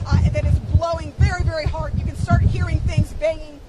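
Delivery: normal talking voice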